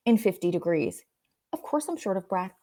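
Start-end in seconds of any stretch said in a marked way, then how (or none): 1.5-2.5 dry, obvious tone, softer; matter-of-fact. Shown as a demonstration. Answering her own panic, calmly matter-of-fact. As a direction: deflate the panic with a shrug in the voice. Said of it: "a joking way to remind you".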